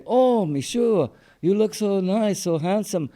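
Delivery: high-pitched mock French accent; fake French accent. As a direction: imitate a Texan faking a French accent. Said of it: "tried to speak with a false French accent".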